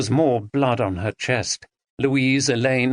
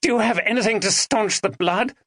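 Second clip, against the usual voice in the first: Ben, urgent; 10 semitones higher than usual. A narrator's reading. Ben asks Maggie.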